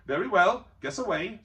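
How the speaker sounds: a hint of a cheeky hobbit; lightly voiced hobbit; lightly voiced Bilbo. A narrator's reading. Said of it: "said Bilbo".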